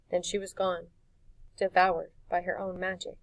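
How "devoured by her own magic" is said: plain reading voice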